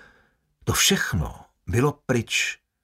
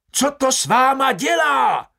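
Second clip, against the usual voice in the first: high, louder little Frenchman's yell; panicked shout; 13 semitones higher, 5 dB louder than usual. A narrator's reading. Delacroix shouting in terror to the guards, screeching. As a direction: yell it in terror across the cell. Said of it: "hlas toho malého Francouze".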